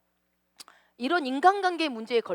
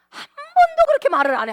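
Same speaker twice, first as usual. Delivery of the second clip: high, loud emphatic cry; shouted emphatic denial; 12 dB louder than usual. Real, not acted. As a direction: exclaim it sharply to the crowd.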